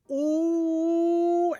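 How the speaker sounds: long, high, loud "oooh"; a big scandalized "ooooh"; drawn-out ooh. Real, not acted. Storyteller reacting to brother's back talk, long high ooh.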